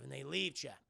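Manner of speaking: ordinary delivery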